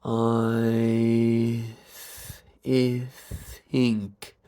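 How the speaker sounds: long "I" gliding into a lengthened "th"; really slowly. Shown as a demonstration. Coach demonstrating the hidden "if", really slowly. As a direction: separate each sound in "I think". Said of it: "If I do it really slowly".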